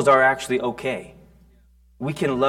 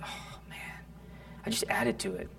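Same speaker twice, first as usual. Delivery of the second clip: whispered dismay; 6 semitones higher, 19 dB quieter than usual. Retold or imitated. Realizing he made it worse.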